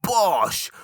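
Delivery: spring the good news; triumphant announcement. Shown as a demonstration. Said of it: "it's kind of like".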